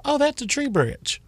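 surprised. Retold or imitated. Retold surprise at fallen branch.

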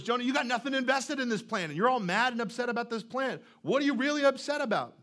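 God calling out a sulking prophet, pressing harder to the end; incredulous challenge. Retold or imitated. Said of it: "In other words".

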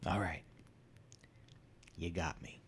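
soft whispered admission; caught, resigned whisper. Retold or imitated. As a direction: give up quietly, caught red-handed. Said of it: "And I said".

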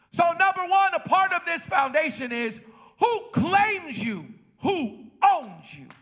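ordinary talk

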